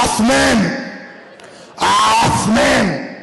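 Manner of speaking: Osman with a throaty retching O; guttural Osman. Retold or imitated. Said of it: "You sound like you're about to throw up".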